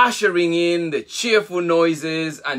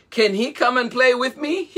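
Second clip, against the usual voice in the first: high, laughing and eager; high delighted baby hippo asking to play; happiest voice; 10 semitones higher than usual. Acted. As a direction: beg to play, bursting with joy. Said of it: "in the happiest voice".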